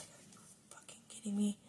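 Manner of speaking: whispered disbelief; angry whisper at the phone settings; incredulous whisper. Retold or imitated. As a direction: hiss it under your breath in shock. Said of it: "I'm like".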